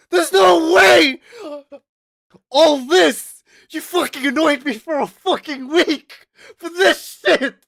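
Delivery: high, loud frustrated yell with a cry; outraged yelling. Retold or imitated. Angry at the rival who annoyed him all week, loud yell. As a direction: yell in disbelief that it's over so fast. Furious at the one-punch letdown.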